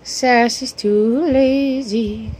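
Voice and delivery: lilting, slowed-down teasing; teasing pet talk. Real, not acted. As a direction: tease the dog, stretching TOO LAZY.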